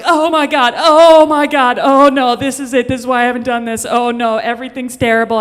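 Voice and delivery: loud, high, frantic wailing; panicked spiral. Retold or imitated. She panics out loud after being pushed off the bed. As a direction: melt down loudly in a full panic. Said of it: "And I'm like".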